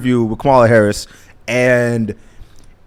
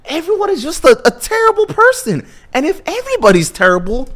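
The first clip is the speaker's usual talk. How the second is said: high-pitched whining cartoon voice; mock-upset, squeaky impression; Cartman; faster than usual. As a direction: whine the complaint to his mom. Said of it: "he's talking to his mom".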